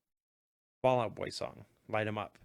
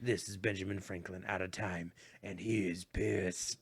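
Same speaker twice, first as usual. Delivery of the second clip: trailer announcer voice; 4 semitones lower, 4 dB quieter than usual. Pretend. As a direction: growl it like a movie trailer. Movie trailer voice joke.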